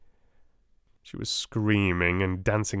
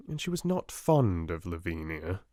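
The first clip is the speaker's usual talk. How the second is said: usual speaking voice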